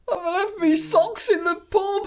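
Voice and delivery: very high, slow, loud hammed-up wail; mock sobbing wife. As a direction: squeal it like a tearful wife. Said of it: "And then she says".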